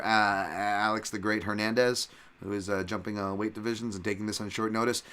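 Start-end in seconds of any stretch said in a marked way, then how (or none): none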